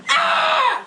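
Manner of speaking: high startled shriek with a laugh in it; imitated scream. Retelling her friend's nightmare scream in the tent, high laughing mock shriek. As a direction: copy the scream she let out in her sleep. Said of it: "She screamed like".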